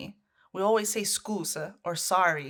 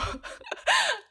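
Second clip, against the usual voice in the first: sudden high laugh; a squealing laugh at the absurd menu; high burst of laughter. Real, not acted.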